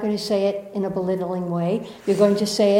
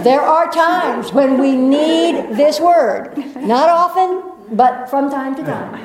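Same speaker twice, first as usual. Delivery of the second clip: slow, raised, deliberate; emphatic and slow; 4 semitones higher, 5 dB louder, slower than usual. Real, not acted. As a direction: draw it out to make the point. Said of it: "emphatic speech".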